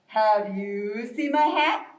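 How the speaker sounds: lightly voiced slow, low bear; inquiring bear. Bear asking turtle about a lost hat, slow and low. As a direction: stretch the question out slowly. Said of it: "Bear comes up to Turtle and says".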